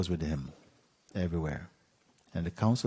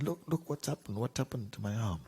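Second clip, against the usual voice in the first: high, softer whimpering plea; wounded king's acted lament; pained complaint; 5 semitones higher, 5 dB quieter than usual. A narrator's reading. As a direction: whimper it high, acting hurt. Injured king shows his arm.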